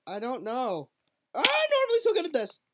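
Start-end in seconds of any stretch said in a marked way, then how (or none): 1.3-2.6 whiny protest. Real, not acted. Frustrated at failing the map.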